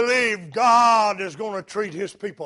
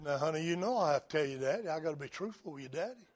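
gentle mother; 3 semitones lower, 10 dB quieter, much faster than usual. Retold or imitated. Mother answers son gently.

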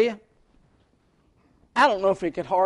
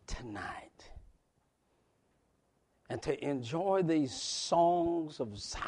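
slow, soft whisper of devotion; 7 dB quieter, much slower than usual. Acted. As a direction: breathe it to the congregation.

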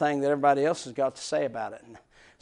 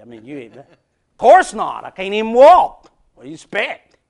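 ordinary talk, nothing marked

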